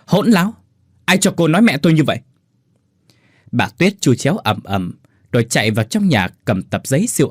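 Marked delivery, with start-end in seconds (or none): 0.1-2.2 high, fast furious roar; husband roaring at wife; roaring rebuke. Acted. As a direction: bellow at her for insolence. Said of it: "tiếng Thanh gầm lên".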